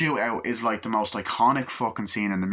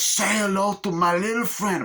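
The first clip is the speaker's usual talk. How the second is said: high, louder gangster taunt in a put-on voice; Tony Montana impression; 10 semitones higher, 3 dB louder than usual. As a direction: sell the famous line as the gangster. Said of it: "Then he goes".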